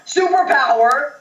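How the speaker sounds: high, drawn-out incredulous question; shocked disbelief. Real, not acted. Player reacting to a superpower move, high and incredulous.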